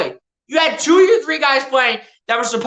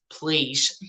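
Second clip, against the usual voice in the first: usual speaking voice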